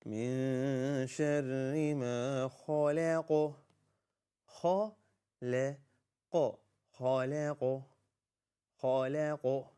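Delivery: slow, measured tajweed recitation on a near-level tone; Quran recitation. Shown as a demonstration. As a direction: recite it slowly with long held vowels. Recites verse for learners.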